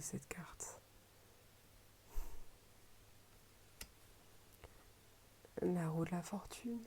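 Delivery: soft admiring whisper. Real, not acted. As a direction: breathe the words quietly. Whispered card names. (murmuré tout bas)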